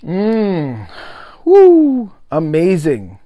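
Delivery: ordinary talk, nothing marked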